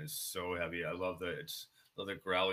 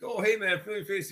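friendly greeting; 14 semitones higher, 11 dB louder than usual. Retold or imitated. Quoting tattoo artist.

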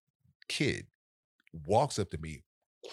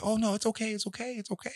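reassuring; faster than usual. Retold or imitated. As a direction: soothe him, much higher and gentler. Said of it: "And I said".

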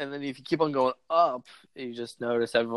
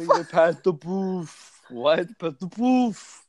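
slow, higher imitation of a friend; a drawn-out, teasing imitation of a friend; imitating a friend; 5 semitones higher, 4 dB louder, slower than usual. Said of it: "Garrett Stacy".

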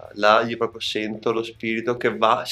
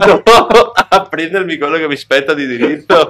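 laughing; 4 semitones higher, 9 dB louder than usual. Real, not acted. Joking about claiming her due.

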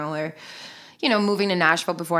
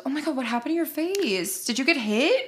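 imitating a tactless acquaintance; 6 semitones higher than usual. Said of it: "someone literally goes".

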